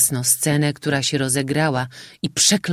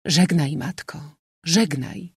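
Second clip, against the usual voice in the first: usual speaking voice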